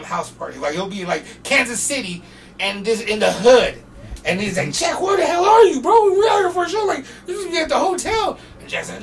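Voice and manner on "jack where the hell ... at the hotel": high, loud frantic demand; exasperated manager. Retold or imitated. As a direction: imitate yelling down the phone at a missing rapper. Acted exasperated manager hunting Jack.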